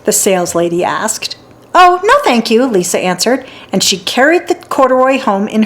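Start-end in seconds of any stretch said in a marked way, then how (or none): 1.7-2.6 put-on eager little girl. Girl answers the saleslady.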